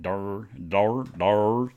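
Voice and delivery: "Dur" said with a drawn-out r, like "durr"; goofy, separated "dur, dur, dur"; demonstrated "dur". Shown as a demonstration. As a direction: repeat "dur" as a dopey word for door. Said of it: "that's how I say it".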